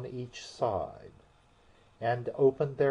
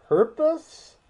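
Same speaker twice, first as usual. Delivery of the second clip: much higher, louder question; surprised correction; 8 dB louder than usual. A narrator's reading. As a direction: shoot the word up in surprise.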